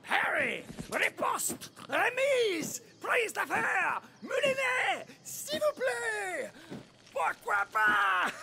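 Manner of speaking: high, flamboyant swordsman's shouts; theatrical, drawn-out duelling cries; fencing battle calls. Acted. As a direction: call each move with a flourish. Knight calling out fencing moves.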